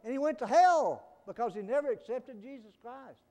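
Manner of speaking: loud, high-pitched preacher's cry; full-force preaching. Real, not acted. Preacher warns congregation about hell, full-force preaching.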